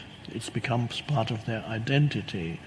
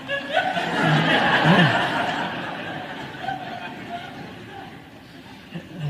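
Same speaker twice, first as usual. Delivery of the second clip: hearty laugh; 8 semitones higher than usual. Real, not acted. Audience laughs at the 3 AM worry.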